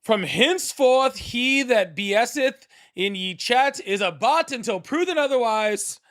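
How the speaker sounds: high, slow, grandly proclaimed medieval decree. Pretend. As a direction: put on your best old-time herald. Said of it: "In my best medieval voice".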